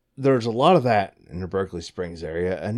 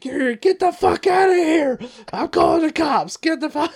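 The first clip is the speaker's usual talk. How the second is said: angry shout; 4 dB louder than usual. Retold or imitated. Staff throwing out the pesticide huffer, furious shout. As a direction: throw her out and threaten the cops. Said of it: "they're like".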